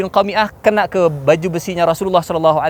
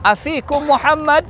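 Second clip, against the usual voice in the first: high, stretched questioning call; 11 semitones higher, slower than usual. Acted. Abu Sufyan to Muslims on the mountain, high slow call. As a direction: call slowly up to the enemy. Commander calls up to hidden enemy.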